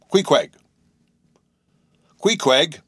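loud, high call through a door. A narrator's reading. Narrator calling through the locked door, loud and high. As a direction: call his name urgently.

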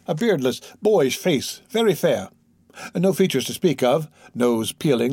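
ordinary delivery, nothing marked

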